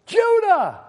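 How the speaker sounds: high, ringing cry of the name; triumphant exclamation. Real, not acted. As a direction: call out the answer in triumph. Answers own question to congregation.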